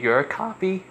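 real voice crack on a high question. Real, not acted. Girl questions whether he is a copy, voice cracking. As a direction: pitch jumps up and cracks. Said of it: "Did my voice just cracked?".

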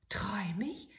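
whispered, amazed; astonished whisper. Acted. Soldier seeing the manikin, whispered. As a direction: wonder if it's a dream.

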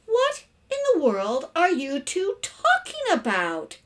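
high, slow, emphatic question; exasperated. A narrator's reading. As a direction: demand it word by word. Exasperated mother questions both.

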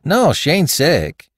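eager high-pitched denial; emphatic, stretched-out denial; with alacrity. A narrator's reading. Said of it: "replied the woman with alacrity".

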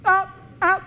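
gasping hiccups between words; hiccuping. Acted. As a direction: fake hiccups on the phone. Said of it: "es que tengo hipo".

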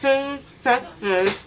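talking through gauze; mouth full of gauze. Real, not acted. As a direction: mumble around gauze in the mouth. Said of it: "when that comes out of your mouth".

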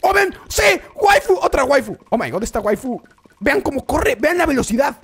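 ordinary talk

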